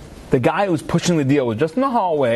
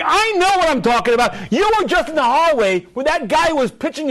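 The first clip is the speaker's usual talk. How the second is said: much higher, furious; yelling father; 9 semitones higher than usual. Retold or imitated. Father yelling at Joshy for insulting him. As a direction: lay into the son.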